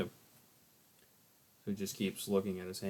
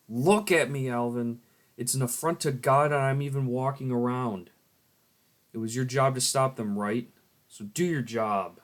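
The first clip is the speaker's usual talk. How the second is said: louder, higher, furious scolding; angry rebuke; 5 semitones higher, 8 dB louder than usual. Acted. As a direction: demand he do his job, full force. Character berates Alvin.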